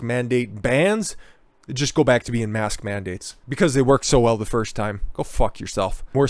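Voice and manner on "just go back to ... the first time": ordinary talk, nothing marked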